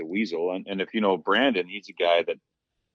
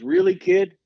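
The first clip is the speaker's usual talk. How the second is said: veteran's mock challenge; 10 semitones higher, 6 dB louder, slower than usual. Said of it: "So he goes".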